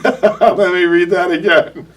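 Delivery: giggling through the words; slow, laughing reread. Real, not acted. Laughing while rereading a name.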